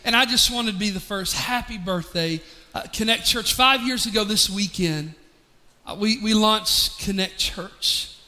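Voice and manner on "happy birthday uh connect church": softer, lower, sincere birthday wish; heartfelt greeting. Real, not acted. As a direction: say it gently, from the heart.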